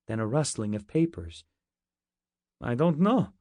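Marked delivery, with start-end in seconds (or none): 2.6-3.3 put-on higher, evasive Russian accent. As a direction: feign ignorance in broken English. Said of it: "said Levka".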